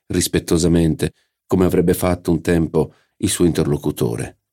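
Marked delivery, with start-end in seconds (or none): none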